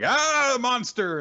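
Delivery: long high loud shriek, then the words; hammed-up scream of a fleeing bystander; mock terrified scream. Retelling people seeing Pyra in the arcade, with a mock scream.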